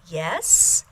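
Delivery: YES rising like a question; inquiring tone. Shown as a demonstration. Someone answering to their name, inquiring rising YES. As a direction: answer to your name with YES sliding upward. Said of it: "in that inquiring tone".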